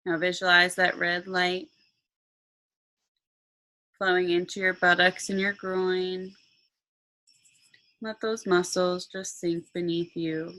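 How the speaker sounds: slow meditation voice with long pauses; guided meditation. Acted. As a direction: guide them calmly into relaxation.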